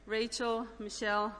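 normal talking voice

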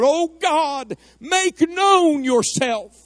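high, drawn-out plea to God; crying out to God. Real, not acted. Preacher cries out to God for revival, high and pleading. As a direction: cry it out in prayer, stretching the words high. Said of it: "I want to cry out".

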